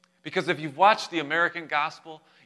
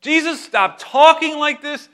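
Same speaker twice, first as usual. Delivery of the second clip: high, loud comic scolding; mock rebuke; 13 semitones higher, 11 dB louder than usual. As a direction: act out an exasperated disciple telling off his master. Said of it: "He began to rebuke Jesus".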